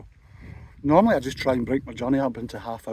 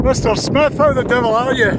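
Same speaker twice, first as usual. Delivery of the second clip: calling out loudly in the wind; hearty loud hello; loud greeting; 10 semitones higher, 9 dB louder than usual. Real, not acted. Greeting fellow vlogger on windy summit.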